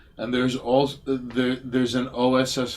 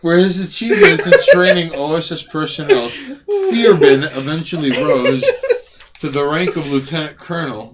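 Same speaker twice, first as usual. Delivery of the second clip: loud exaggerated reread; 6 semitones higher, 11 dB louder than usual. Rereads louder after correction war.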